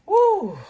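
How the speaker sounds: sudden loud high woo to let off steam; shaking off real anger with a loud woo; release woo. Real, not acted. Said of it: "I gotta calm down".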